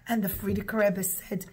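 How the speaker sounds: usual speaking voice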